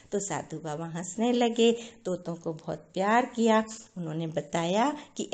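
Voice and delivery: ordinary delivery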